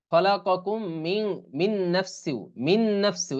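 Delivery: measured recitation of খলাকাকুম মিন, then the join repeated; মিন নাফসিউ run together on a doubled n; idgham into nun. Shown as a demonstration. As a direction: hold a long nasal n in মিন নাফসিউ. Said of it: "এরকম হবে".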